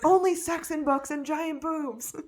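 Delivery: high, silly put-on voice; hammed-up, high-pitched self-parody; mock self-quote. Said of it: "I'm like".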